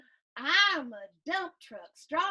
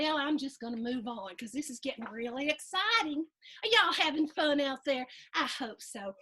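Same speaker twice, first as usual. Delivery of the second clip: ordinary delivery, nothing marked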